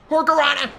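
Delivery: high, loud, fast exclamation; excited call-out. Real, not acted. Player calling out a hurricanrana, excited shout. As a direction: shout the move's name in one quick burst. Calls out a wrestling move.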